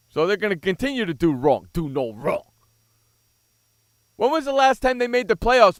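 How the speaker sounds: loud scornful jeering at the claim; loud sarcastic rant. Real, not acted. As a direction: throw his words back at him, mocking and loud. Mocking Skippy's Cowboys claim.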